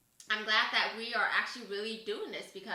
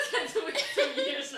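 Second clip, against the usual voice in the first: laughing; 12 semitones higher, 6 dB louder than usual. Real, not acted. Correcting herself, laughing.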